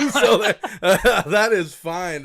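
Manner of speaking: chuckling through the words; laughing. Real, not acted. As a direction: laugh while saying it. Host laughs at own rank.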